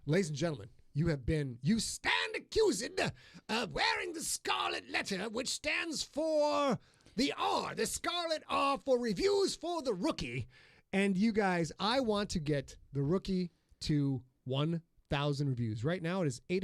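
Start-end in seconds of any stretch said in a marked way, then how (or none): 1.6-10.5 high, slow, booming accusation; mock prosecutor.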